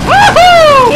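very high, loud "Woohoo"; whooping cheer. Real, not acted. Gamer cheering his kill, high loud whoop. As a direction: whoop it out in triumph. Celebrates in chaos.

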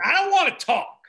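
sharp angry outburst. Acted.

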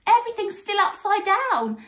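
high, sad complaint; Nana Sloth. A narrator's reading. Nana Sloth gives up after Herbie's pulling, sad sigh. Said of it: "sighed Nana Sloth sadly".

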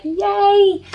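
a high, hammed-up little "yay"; playful pretend cheer to show being happy all the time; mock cheer. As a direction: squeal it like a child who is always happy. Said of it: "we were like".

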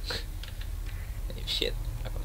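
dismissive whisper; whispered aside. Real, not acted. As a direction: scorn them under breath.

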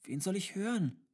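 put-on high child's question; puzzled child. A narrator's reading. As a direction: ask in a puzzled child's voice, pitch lifted. Child repeats question to tutor. (hohe, fragende Kinderstimme)

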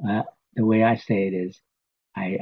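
ordinary delivery

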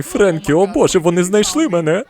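feigned panic. Pretend. Dad pranks Frankie that police found him, mock panic. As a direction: play the hunted fugitive for a kid.

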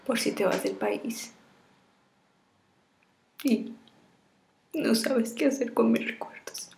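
tearful, half-whispered farewell; tragic heroine sorrow. Acted. Farewell video to lover.

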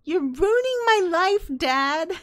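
put-on the classic teenage scream at a parent; teen fury. Daughter yelling at dad that he ruins her life. Ari yells at father.

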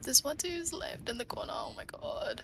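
tense unvoiced whisper. Real, not acted. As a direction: hush it to a whisper. Tense whisper spotting enemy.

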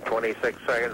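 normal talking voice

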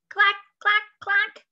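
playful typewriter sound effect; typewriter clack. Pretend. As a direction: tap it out like typewriter keys. Typing sound imitated.

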